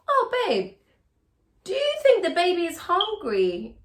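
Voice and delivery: sweet cooing question to husband; sweet babe ask. Shown as a demonstration. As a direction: coo sweetly, then ask. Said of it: "phrase your statements like it's a question".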